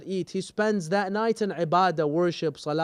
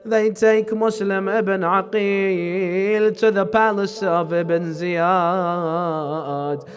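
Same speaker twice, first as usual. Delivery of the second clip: mourning elegy; 4 semitones higher, 7 dB louder than usual. Acted. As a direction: preach it as a rising lament for the martyr. Preacher builds into elegy recitation.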